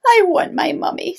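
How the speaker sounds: put-on high, loud, babyish wail; whimper-cry it like the tiniest owl; little owl wailing. Smallest owl Bill crying for his mummy, high and wailing.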